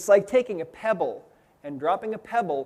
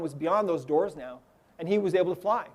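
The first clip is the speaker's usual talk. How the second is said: ordinary delivery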